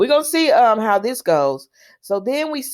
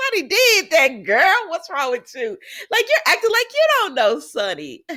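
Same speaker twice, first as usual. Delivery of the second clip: incredulous scolding; 9 semitones higher than usual. Retold or imitated. Scolding Nina, laughing.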